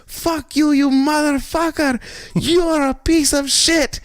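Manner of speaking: high-pitched cursing tirade, slowing down; retelling a cabbie yelling at another cabbie from his window, high, slow mock rage; angry yell. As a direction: scream abuse at the rival driver, high and drawn out. Other cab driver cursing them out.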